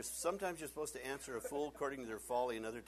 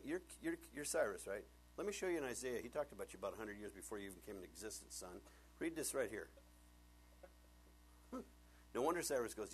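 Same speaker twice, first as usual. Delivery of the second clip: ordinary talk, nothing marked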